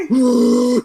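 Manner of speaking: high, loud mock scream into the mic; imitating a mic scream. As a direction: belt out a hammed-up whoop like a wrestler. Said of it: "He just fucking screamed".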